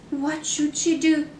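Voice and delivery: ordinary delivery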